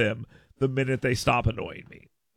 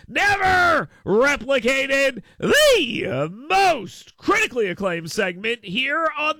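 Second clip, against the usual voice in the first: high, slow, booming segment introduction; hammed-up announcer; 9 semitones higher than usual. Real, not acted. Host introducing his show's daily segment, hammed-up announcer voice. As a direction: stretch each billing like a ring announcer.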